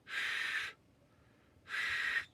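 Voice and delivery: a whispered "sh" with the teeth clenched and the tongue up toward the roof; O'odham sh. Shown as a demonstration. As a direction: clench the teeth and hiss a soft "sh". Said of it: "That's how you make that O'odham S H sound".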